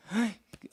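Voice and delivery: sharp high gasp; shocked gasp. Acted. Father hears bad news.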